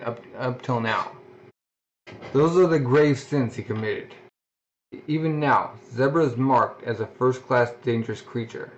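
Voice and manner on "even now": ordinary delivery, nothing marked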